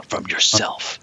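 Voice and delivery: ominous, breath-heavy half-whisper; ominous McCoy. Retold or imitated. Retelling McCoy's line to Kirk, ominous. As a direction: let it drop like a dark warning. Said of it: "McCoy drops the ominous".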